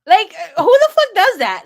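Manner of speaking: loud, high disbelieving exclamation; incredulous outburst. Real, not acted. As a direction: explode in disbelief. Disbelief at his call.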